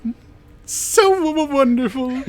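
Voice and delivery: high falsetto admiration; delighted daughter. Acted. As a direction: gush in a high-pitched woman's voice. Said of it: "and she's like".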